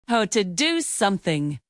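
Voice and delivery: usual speaking voice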